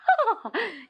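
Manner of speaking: high gleeful cackle; a queen chuckling at her trick; mocking laugh. A narrator's reading. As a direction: laugh at his predicament.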